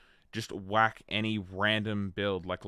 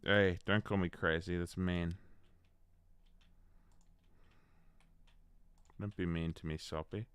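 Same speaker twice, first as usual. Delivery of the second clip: mock hurt; 2 semitones lower, 3 dB quieter than usual. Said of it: "I can't keep a straight face on that".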